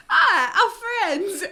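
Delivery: high, loud doting voice; fond Aussie. Retold or imitated. Australian mocking how Aussies see Kiwis, high doting gush. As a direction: gush over them like a big brother. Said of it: "We, we're like".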